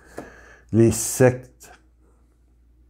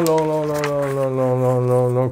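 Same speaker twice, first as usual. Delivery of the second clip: long, long, long stretched in a sing-song; drawn-out repetition; 3 semitones higher than usual. Shown as a demonstration. Preacher mocking a very long email, sing-song. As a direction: repeat long to show the length. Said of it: "Un long".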